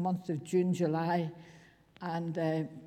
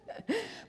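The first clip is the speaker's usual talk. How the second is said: short high laugh; 6 dB quieter than usual. Real, not acted. Laughing at hospital stay memory.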